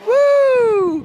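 high, loud cheering woo. Real, not acted. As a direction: whoop as they hit the water. Kids splash into the pool.

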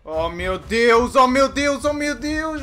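drawn out, loud and rising in panic; panicked oh my God. Real, not acted. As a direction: cry out in real panic. Monster chasing him. (pânico em voz alta)

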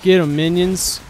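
rallying shout. Real, not acted. Commanding minions to attack.

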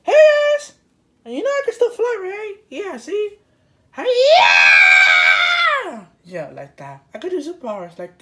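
put-on high-pitched young superhero voice; high kid hero. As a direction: brag like a little flying hero. High flying character.

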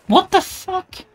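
high, slow shocked squeak; squeaky shocked. Real, not acted. Gamer startled by the game, high shocked squeak.

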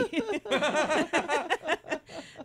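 high, loud run of laughs; real cackling laughter. Real, not acted. Host after botching a birthday name, laughing helplessly. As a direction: keep cracking up helplessly. Cracks up at own mistake.

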